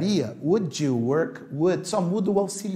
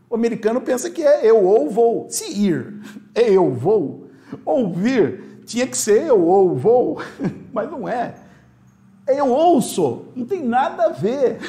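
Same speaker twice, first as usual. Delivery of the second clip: rising to laughing disbelief; amused, building into a laugh; 7 semitones higher, 6 dB louder than usual. Real, not acted. Teacher joking about Americans learning Portuguese, laughing. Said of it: "Você acaba com o americano".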